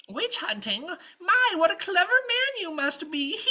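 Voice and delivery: very high, loud cooing witch; flattering witch. Acted. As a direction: gush falsely over the clever knight.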